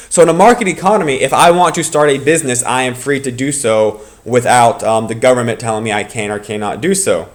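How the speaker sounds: ordinary talk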